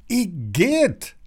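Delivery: high-pitched squeal of disgust; mild disgusted cry. A narrator's reading. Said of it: "schreit Lilly".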